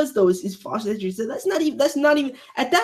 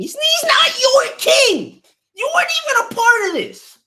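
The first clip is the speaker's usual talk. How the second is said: crying angry shout; 9 dB louder than usual. Real, not acted. Denying the crowd's king, a crying shout. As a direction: scream it through tears of rage.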